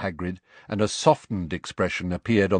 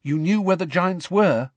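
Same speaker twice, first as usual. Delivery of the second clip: higher, louder disbelief; can't believe what he hears; Harry amazed at Hagrid; 11 semitones higher, 4 dB louder than usual. A narrator's reading.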